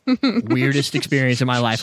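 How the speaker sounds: usual speaking voice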